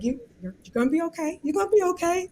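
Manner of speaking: put-on soft, high-pitched soothing of an inner child; high, tender self-soothing voice; parental reassurance. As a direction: comfort gently, like a parent to a little one. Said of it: "tell myself like a parent".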